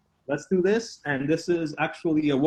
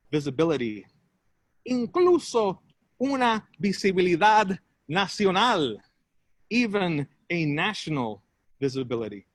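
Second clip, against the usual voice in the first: measured spoken-word with long pauses; deliberate bilingual poem delivery; poetry recital; 3 semitones higher than usual. Acted. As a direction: recite it in short, separated phrases. Performs improvised bilingual poem.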